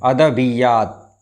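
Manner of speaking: अदबियात said slowly, every syllable clear; अदबियात, धीरे और साफ़ उच्चारण; careful pronunciation. Shown as a demonstration. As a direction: spell out अदबियात slowly with the doubled y. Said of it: "बे के नीचे ज़ेर और ये के ऊपर तशदीद है".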